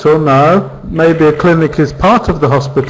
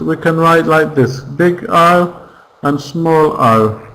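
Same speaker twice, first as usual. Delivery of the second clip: ordinary delivery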